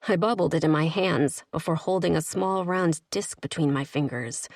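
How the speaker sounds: usual speaking voice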